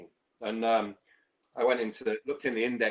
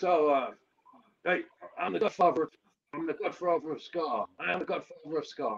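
higher-pitched put-on voice of a boastful caller; mock shouting caller; 6 semitones higher, 3 dB louder than usual. Caller on the phone boasting to the narrator, pitched-up mock shout. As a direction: mimic him bragging down the phone, pitched up. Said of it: "he's come shouting down the phone".

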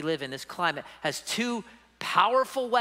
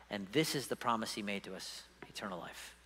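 hushed scripture reading; 8 semitones lower, 9 dB quieter than usual. Acted.

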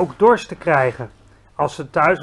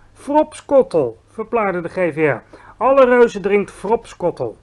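giant's voice; 4 semitones higher, 6 dB louder than usual. Acted. Giant explaining his drink to Sophie, proud.

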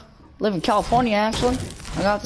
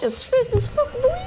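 very high, put-on squeaky voice; playful falsetto squeal; squealed catchphrase.

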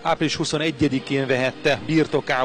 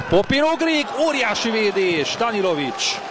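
excited commentary; 6 semitones higher than usual. Real, not acted. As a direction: cry out at the big save. Big save, live commentary.

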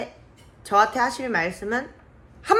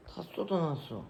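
normal talking voice

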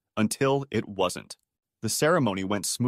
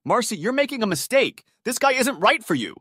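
insistent; 11 semitones higher than usual. A narrator's reading. Drunk uncle insists.

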